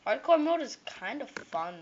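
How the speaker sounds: ordinary delivery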